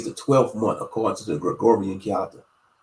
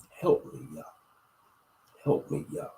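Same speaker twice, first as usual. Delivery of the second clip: whispered; 4 semitones higher, 9 dB quieter than usual. Shown as a demonstration. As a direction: whisper "Help me, Yah." with no voice at all. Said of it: "just say it like that".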